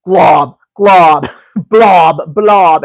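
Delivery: loud, bouncy chant of glob and blob; playful loud chant mocking thin layers. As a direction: stamp each glob out loud like slapping on paint. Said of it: "I'm like".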